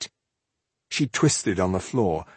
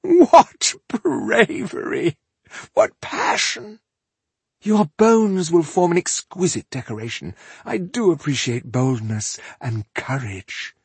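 delighted elf villain; 8 semitones higher than usual. A narrator's reading. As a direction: savour your foe's courage with mocking delight. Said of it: "Her opponent laughed with delight".

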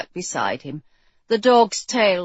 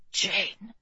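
soft, confiding murmur; whispered; 7 dB quieter than usual. A narrator's reading. King leaning to the bride's ear, soft whisper. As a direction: say the name gently into an ear. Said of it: "he'd leaned to whisper in her ear".

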